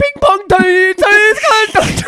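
very high, loud mock shrieking; hammed-up martial-arts star yelling; Jackie Chan. Shown as a demonstration. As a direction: shout in a piercing high caricature. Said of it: "good Jackie Chan".

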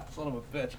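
strained after drink went down wrong; breathless after choking. Real, not acted. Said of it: "this went down the wrong pipe".

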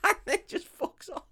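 very high, squeaky laughing speech; laughing hard. Real, not acted. Teller cracking up at his own film plot, real laughter. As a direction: crack up while getting the words out.

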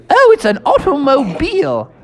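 put-on high, hearty old English toad; jolly Mister Toad. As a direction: boast like a merry old gentleman toad. Mister Toad voiced in story.

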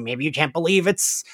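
ordinary talk

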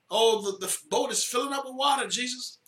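mock panic. Disciples panicking in the boat.

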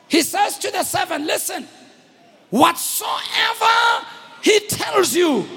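high, ringing exhortation; full-force preaching. Real, not acted. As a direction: cry it out to the congregation, stretching key words.

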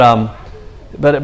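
usual speaking voice

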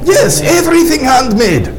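put-on high, thick Russian accent host. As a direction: keep the heavy Russian accent going. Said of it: "Greets you with thick Russian accent".

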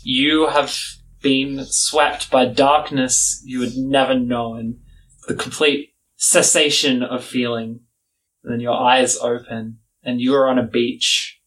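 ordinary delivery

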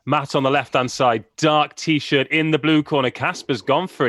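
excited live play-by-play; sports commentary. Shown as a demonstration. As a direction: call the thumb war like a boxing match. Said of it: "I'll just commentate on it".

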